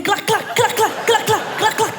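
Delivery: rhythmic clac sounds. Acted.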